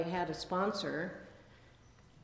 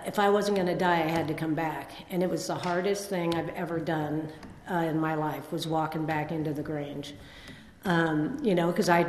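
ordinary talk, nothing marked

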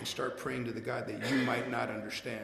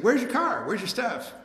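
high, loud, startled demand; alarmed sister; 10 semitones higher, 6 dB louder than usual. Retold or imitated. Sister finds his stuff gone, high loud alarmed questions. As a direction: sister barges in shocked at the empty room.

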